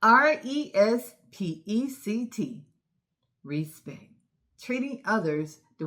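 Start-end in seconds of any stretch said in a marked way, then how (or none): 0.0-2.6 letters spelled one by one, slow; spelling out slowly. Shown as a demonstration. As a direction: spell it out for the kids. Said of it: "Spell it".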